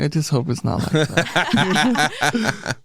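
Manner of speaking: slow, laughing, slightly pleading; laughing plea. Retold or imitated. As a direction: beg through a laugh, drawn out. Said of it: "I'm like".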